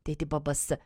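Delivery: ordinary delivery, nothing marked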